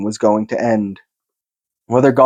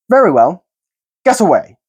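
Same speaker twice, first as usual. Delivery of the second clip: Bilbo's voice; 7 semitones higher, 4 dB louder than usual. A narrator's reading. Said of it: "said Bilbo".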